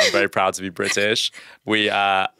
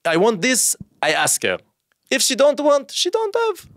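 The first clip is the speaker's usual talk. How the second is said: high, broken-English mock Israeli guy; mock Israeli accent. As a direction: voice a blunt Israeli man in English. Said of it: "there's no, like".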